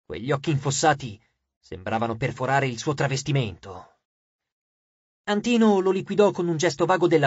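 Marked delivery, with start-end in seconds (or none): none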